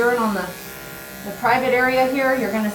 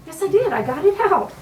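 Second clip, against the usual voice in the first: usual speaking voice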